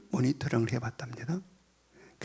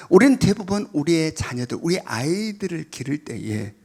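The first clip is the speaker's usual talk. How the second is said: ordinary delivery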